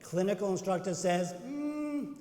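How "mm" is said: high, doubtful hum; reluctant hedging. Acted. Acting out hesitant clinical instructor.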